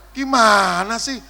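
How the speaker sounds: loud, high drawn-out complaint; slow exasperated holler; mock exasperation. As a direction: stretch it out in comic protest. Mock protest at congregation's answer.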